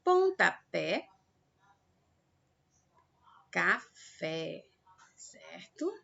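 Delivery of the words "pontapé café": PONTAPÉ and CAFÉ slowly, stress on the last syllable; teacher's slow oxytone examples, "Pontapé, café"; final-syllable stress. Shown as a demonstration. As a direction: draw out "Pontapé, café", landing on PÉ and FÉ. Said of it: "quando a sílaba tônica é a última".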